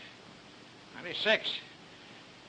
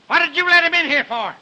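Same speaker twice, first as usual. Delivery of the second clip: raised, louder angry demand; angry scolding; 7 semitones higher, 6 dB louder than usual. Acted. Boss blames servant for admitting the reporter, angry scolding. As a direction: tear into the servant.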